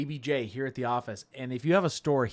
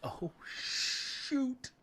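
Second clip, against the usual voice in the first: whispered surprise; 11 semitones higher, 8 dB quieter than usual. Real, not acted. Reacting to OSL.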